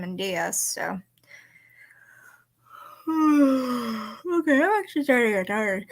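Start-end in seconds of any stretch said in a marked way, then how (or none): 3.1-4.3 drawn-out weary sigh; long sigh. Real, not acted. Streamer getting tired late in the stream, long sigh.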